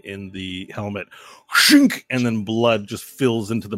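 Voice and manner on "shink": high, loud blade "shink"; sudden high-pitched slicing noise; imitated stab noise. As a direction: make a sharp stabbing sound effect. Imitates machete stabbing into neck.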